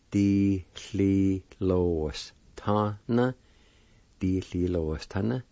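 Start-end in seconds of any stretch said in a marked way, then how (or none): 0.1-3.3 slow, separated syllables for a learner. Shown as a demonstration. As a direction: sound it out slowly, piece by piece. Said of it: "It's pronounced like this".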